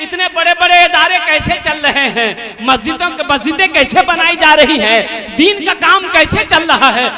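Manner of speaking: loud rousing fundraising appeal; rally the crowd to give; preaching appeal. Acted. Fundraising appeal at full force. (जोशीला धार्मिक appeal)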